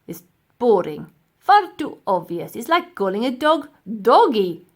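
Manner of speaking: loud dismissive; sneering professor. Acted. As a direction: look down on the idea.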